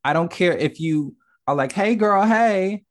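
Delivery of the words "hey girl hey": lightly hammed-up, higher-pitched sassy hail; flamboyant greeting. Imitating a flamboyant friend saying hello, lightly camp. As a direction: sing out a playful, higher greeting.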